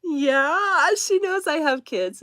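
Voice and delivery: high, emphatic retold reply to a child; amused obvious answer. Mother answering her child's surprised question.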